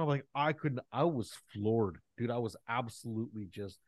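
usual speaking voice

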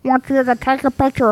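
lightly voiced Kermit the Frog puppet; a hint of a cartoon frog voice. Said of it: "that's my Kermit the Frog voice".

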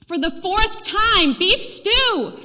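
loud, high, slow exasperated reply; fed up, spelling it out; wife snapping back loudly. Acted.

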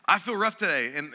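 ordinary talk

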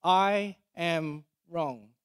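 very slow, a gap after each word of "I am wrong"; slow, separated words. Shown as a demonstration. Preacher voicing shame as identity, slow separated words. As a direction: set down "I", "am", "wrong" one by one.

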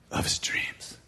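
whispered reveal; preacher whispering the reveal of the dream woman; dramatic whisper. Acted. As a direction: breathe the words like a secret.

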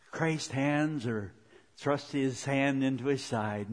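normal talking voice

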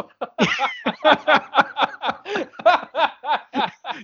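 long, high real laughing fit. Real, not acted. Podcaster amused by their own bad idea, laughing. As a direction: burst out laughing and keep going.

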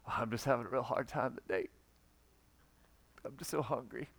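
soft, put-upon complaining voice; self-pitying moan. Pretend. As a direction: fish for sympathy about your hunger. Mocking a gloomy faster.

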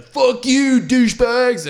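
loud, high shouted taunt; hammed-up angry holler; heckling yell. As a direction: yell it like abuse from a passing car. Heckler yells from passing car.

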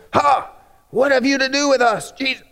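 high, strained demon cry; a would-be demon shrieking at Jesus. Acted. Pastor attempting a demon voice at Jesus. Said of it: "I was trying to do a demon voice".

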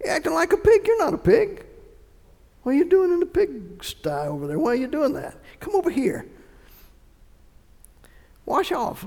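high-pitched incredulous telling-off; mock scolding. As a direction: scold in a high voice, as if at a muddy child. Acts out scolding the muddy wallower.

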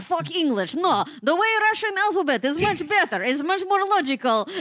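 high, loud mock Russian accent; hammy Russian scorning English. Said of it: "Your fake Russian".

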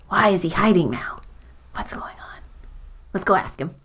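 hushed, curious whisper; sneaking toward the centipede's hole. Acted. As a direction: lean in and whisper. Character whispering near the den.

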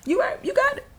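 rising, very high sarcastic yes; sarcastic high agreement. Retold or imitated. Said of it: "I was like".

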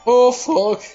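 high, hammed-up tipsy voice; drunk nanny. Nanny who drank too much, in a high mock-drunk voice. Acting out drunk Missus Doubtfire.

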